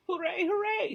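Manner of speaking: high, loud cheering; joyful shout. Acted. Teacher celebrating the high score, shouting hooray. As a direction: shout it out with pure delight. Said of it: "she shouted".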